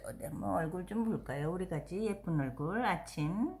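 quiet tender cooing; soft cooing. Real, not acted. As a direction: coo softly to a pet. Cooing softly at dog.

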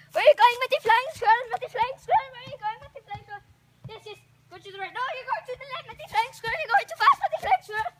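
high, loud and fast calling after him; alarmed shouting. Pretend. Teacher squirrel calls after the student gliding off course, loud and alarmed.

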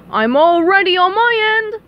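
high, loud, climbing villain voice; Plankton eager. A narrator's reading. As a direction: sing out readiness to the king. Plankton eager before the king.

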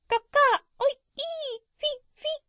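piercingly high parrot squawks; bird language. Acted. Parrot showing the doctor bird language, high squawking. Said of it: "in bird language".

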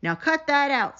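a hint of an irritated principal; cross command. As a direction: tell her off sharply. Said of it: "said the principal".

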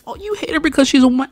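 high, loud, rapid mock accusation; mocking objectors. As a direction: parody a critic jumping on you. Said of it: "don't come in here with that".

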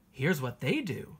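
plain reading voice

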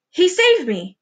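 high, amazed outburst; gasped exclamation. A narrator's reading. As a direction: exclaim it in overwhelmed relief. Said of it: "gasped the centipede".

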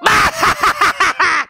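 high, loud evil cackle; maniacal villain laugh. Acted. Mom performing the game's villain laugh. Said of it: "Insert maniacal laugh here".

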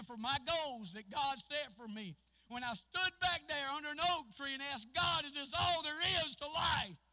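loud, high, shouted preaching; whooping preacher at the climax; full-force preaching. Acted. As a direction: preach it out at the top of the voice.

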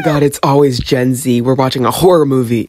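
annoyed yell about Gen Z; loud rant. Pretend. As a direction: yell it in disbelief. Yelling rant.

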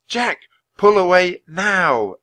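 slow, loud, high shout. A narrator's reading. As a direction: call him back, slow and insistent. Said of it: "Annie shouted again".

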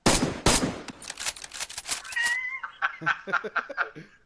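howling laughter, high and loud; loud burst of laughter. Real, not acted. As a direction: crack up hard. Teammate shot short.

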